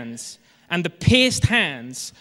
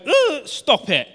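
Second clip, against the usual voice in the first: drawn-out, higher, exasperated snap; a parent at the end of their tether, lightly acted; mock annoyed parent; 7 semitones higher, 3 dB louder, slower than usual. Parent ground down by small children, mild mock exasperation.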